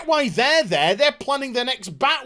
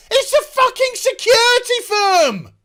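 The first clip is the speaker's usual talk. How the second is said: much higher, louder exploding line; outraged shout; 12 semitones higher, 7 dB louder than usual. Real, not acted. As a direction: blow up at the absurdity. Ranting at plot holes.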